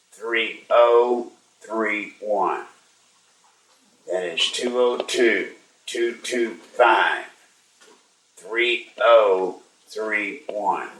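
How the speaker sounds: each digit drawn out, in small groups with pauses; slow dictation. Real, not acted. Host reads listeners a phone number, slow dictation. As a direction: read it out slowly for people writing it down.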